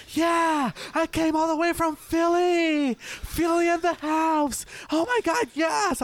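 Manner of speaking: very high, loud, excited squeal; screaming tourist girl. Retold or imitated. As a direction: imitate an overexcited girl screaming. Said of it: "screaming, like".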